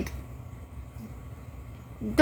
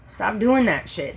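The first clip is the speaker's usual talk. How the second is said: ordinary delivery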